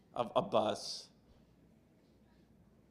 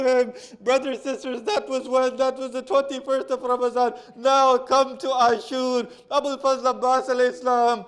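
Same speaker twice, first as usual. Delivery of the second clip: high, loud impassioned preaching, pitched up and pushing; preaching at full force; 8 semitones higher, 5 dB louder than usual. Acted. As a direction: drive the mourning sermon to its emotional peak. Preacher climaxes the Abbas narrative.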